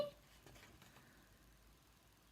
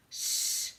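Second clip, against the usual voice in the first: whispered shush. Shown as a demonstration. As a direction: hush the listeners gently. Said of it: "It's story time".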